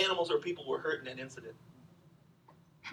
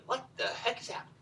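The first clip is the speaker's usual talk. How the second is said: mock bewildered whisper; 4 semitones higher, 8 dB quieter than usual. Bewildered merchants voiced in retelling.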